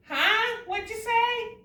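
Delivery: high, playful pretend deafness; teasing "what'd you say?", voice jumping up; mock can't-hear. Teacher pretending not to hear.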